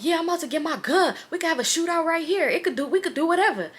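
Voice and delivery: high-pitched mock bravado; hyped-up friend impression. Friend boasting to strangers about a shootout, high mock bravado. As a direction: imitate a drunk friend talking big.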